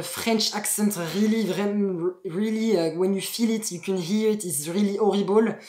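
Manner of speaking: English in an exaggerated French accent; demonstrating a bad French accent; horrible French accent. Shown as a demonstration. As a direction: make the French accent painfully obvious. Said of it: "c'est vraiment horrible. Quand t'as un accent".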